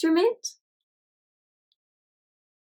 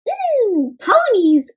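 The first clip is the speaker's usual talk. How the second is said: high, louder, hungry Mm, ponies; lightly voiced dinosaur craving ponies; Penelope voice; 9 semitones higher, 3 dB louder, much slower than usual. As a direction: savour the word like a treat. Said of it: "says Penelope".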